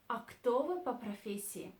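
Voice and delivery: ordinary talk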